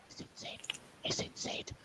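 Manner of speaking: whispered warning of the dreaded name. Retold or imitated. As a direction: breathe it out as a hushed warning. Everyone whispering the sergeant's name.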